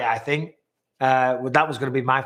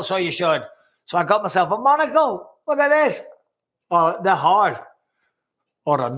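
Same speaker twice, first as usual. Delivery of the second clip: Northern Ireland accent; 3 semitones higher than usual. Shown as a demonstration. Host obliging a viewer's request, in a Northern Ireland accent. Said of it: "bring out the Northern Ireland accent".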